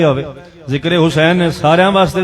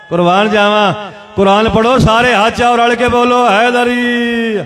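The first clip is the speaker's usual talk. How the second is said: fervent exhortation; 7 semitones higher than usual. Real, not acted. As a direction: rouse the crowd, then hold the last call. Exhorting crowd to chant Haidari.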